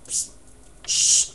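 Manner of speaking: sharp psst into a shh; hushing shush. Acted. Speaker shuts down negative self-talk, hushing. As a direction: cut it off with a shush.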